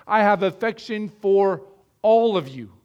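slowly, leaning hard on "all of you"; emphatic. Acted. Quoting Paul's affection for all.

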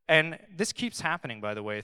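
ordinary talk

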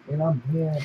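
normal talking voice

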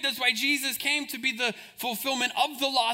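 ordinary talk

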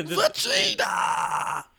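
drawn-out hero's battle yell; mock anime shout. Imitating anime voice actors, drawn-out shout. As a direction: shout the name, stretching it out.

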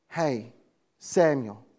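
low, soft call of the name. Retold or imitated. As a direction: call him gently, almost hushed. God calling the young man.

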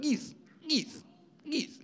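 put-on very high, soft little-kid squeal; excited toddler imitation. Dad mimicking his toddler spotting geese at the park, high child voice. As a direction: imitate a small child calling out in a tiny high voice. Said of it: "So Elisha's like".

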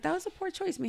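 gentle correction. Shown as a demonstration. Mom showing how she corrects her daughter, gentle and soft. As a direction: soften it and lift the pitch. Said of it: "It's just like".

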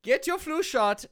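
put-on high, insistent German-accented order; comic German doctor commanding a patient. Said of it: "if I had a German accent".

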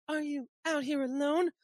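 high, thin-pitched female imitation; put-on woman's voice. Voicing the woman in the comic.

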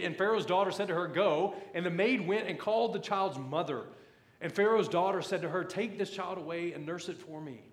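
loud, high preacher's Bible reading; scripture reading. Acted. Preacher reads Pharaoh's daughter's command to his church, emphatic scripture reading. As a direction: punch out the verse for the whole congregation.

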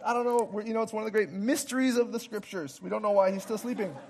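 usual speaking voice

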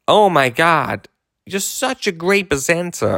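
hammed-up American gush, high-pitched; American accent. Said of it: "excuse the American accent, everybody".

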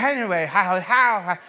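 exhaling hard while talking, mouth on fire; talking through pain. Acted. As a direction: try to chat through burning heat. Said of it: "trying to talk through the pain".

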